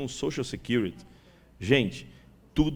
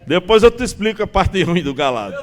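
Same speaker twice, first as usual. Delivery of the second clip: loud, higher, through laughter; laughing; 4 semitones higher, 10 dB louder than usual. Real, not acted. Speaker joking with audience about rude slang, loud and laughing. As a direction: call it out over your own laugh.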